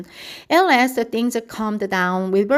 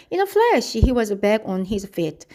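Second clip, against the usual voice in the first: usual speaking voice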